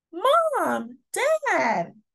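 put-on happy little boy calling out; excited shout. A narrator's reading. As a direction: call out to the parents with joy. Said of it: "Andre shouts".

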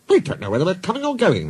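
high, hurried flustered protest. Acted. Coaches complaining at being overworked, flustered protest. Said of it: "they protested".

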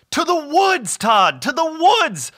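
high, loud rallying cry; storybook hero charging off; excited announcement. A narrator's reading. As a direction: proclaim it with gleeful urgency. Bob announces the destination.

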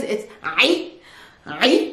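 ay choked out from the throat as "'i"; throaty ayn syllable. Shown as a demonstration. Teacher drilling the ayn, ay squeezed in the throat. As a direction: repeat ay slowly with a squeezed throat. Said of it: "like you're choking yourself".

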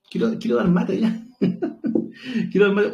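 laughing through the words, eager to finish it. Real, not acted. Commentator laughing as he wants to give mate. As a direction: giggle while wanting the checkmate.

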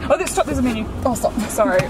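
ordinary delivery

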